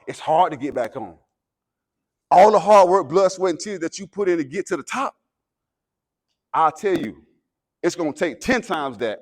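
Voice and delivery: rising, loud motivational preaching; halftime pep speech. Acted. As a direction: fire up the team before the game. Escalating pep speech to team.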